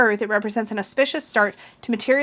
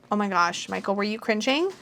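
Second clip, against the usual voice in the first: ordinary talk, nothing marked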